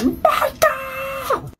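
a shrill, furious shriek of a name, pitched very high; mom's enraged scream of a name; raging scream. Retold or imitated. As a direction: scream her child's name up the stairs in a rage. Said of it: "My mom screams up the stairs in a rage".

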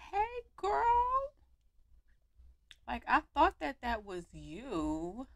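put-on high, bright hey girl; friendly call. Calling Skylar over, high friendly hey girl. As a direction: sing out to a friend. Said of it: "call her over and be like".

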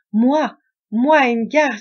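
loud, high outraged cry; indignant outcry. A narrator's reading. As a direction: burst out in shocked anger. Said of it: "s'écria-t-il". (cri indigné et fort)

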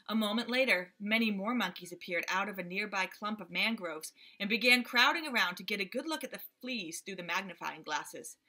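plain reading voice

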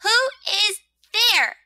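high, loud, spaced-out demand; upset rosebud. Acted. Rosebud upset by all the noise, loud demand. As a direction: call out slowly, each word apart.

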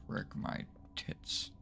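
slow whispered oath; whispered curse. Real, not acted. Startled player cursing in a slow whisper. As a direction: breathe the curse out slowly.

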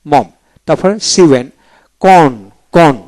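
ordinary talk, nothing marked

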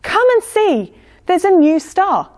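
high-pitched excited calling out; calling to a friend. A narrator's reading. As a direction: urge your friend to come and look. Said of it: "called one of the star watchers to his friend".

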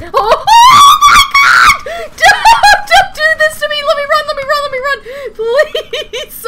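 panicked shrieks, laughing in terror; terrified screaming. Real, not acted. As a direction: scream and beg in real panic. Panicked screaming at game monster.

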